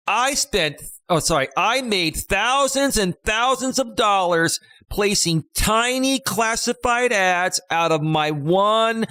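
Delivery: high, slow and emphatic infomercial pitch; emphatic Don Lapre. Retold or imitated. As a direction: sell the tiny classified ads dream, hitting every word. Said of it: "the guy that used to emphasize his words".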